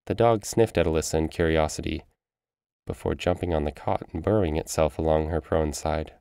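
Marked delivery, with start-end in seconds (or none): none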